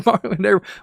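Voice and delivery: loud, high laughing cackle; high burst of laughter. Real, not acted. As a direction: crack up at your own joke. Laughing at own bit.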